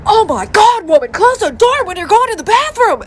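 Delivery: high, loud scolding shout; outraged yell. Retold or imitated. Husband yells at wife to close bathroom door, outraged. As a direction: holler in disgusted disbelief.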